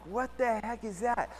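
a retold quiet what-the-heck at the window; hushed puzzlement. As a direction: murmur it so the customer won't notice. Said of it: "And I'm like".

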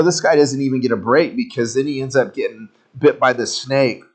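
ordinary talk, nothing marked

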